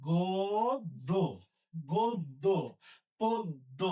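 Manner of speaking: গদ্য stretched out slowly; slow dictation. Shown as a demonstration. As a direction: drawl গদ্য carefully. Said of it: "এটা হবে কি?".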